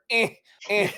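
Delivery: high strained reaching grunts; straining grunts. Retold or imitated.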